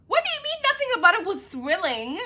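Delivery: can't believe what you're reading; squeaky incredulous question. Real, not acted. Disbelief at reviewer.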